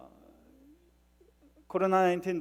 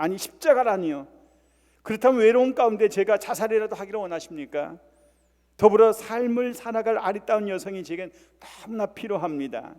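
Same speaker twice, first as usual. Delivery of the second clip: acted anger, higher and louder; indignant protest; 4 semitones higher, 6 dB louder than usual. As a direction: protest to God like an offended man. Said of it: "그때 남성이 화가 나".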